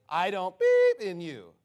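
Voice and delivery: slowly, bleep out the swear word with your voice; self-censored swearing. Retold or imitated. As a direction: say "beep" as a long censor tone. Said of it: "He's saying it with special words".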